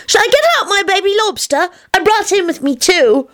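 put-on very high, keen little-kid voice; high childish eagerness; eager child Teddy. A narrator's reading. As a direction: offer it proudly like a small child.

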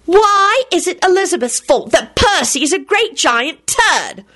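high outraged retort; indignant insult. A narrator's reading. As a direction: spit the insult out. Rosie insulting Percy on phone.

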